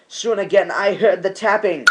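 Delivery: usual speaking voice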